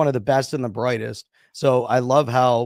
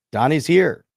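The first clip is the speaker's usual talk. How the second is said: louder, delighted announcement; excited; 2 semitones higher, 6 dB louder than usual. Real, not acted. Cohost cheers as the giveaway winner finally answers, excited. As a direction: celebrate the winner showing up.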